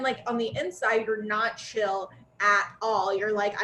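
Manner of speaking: ordinary talk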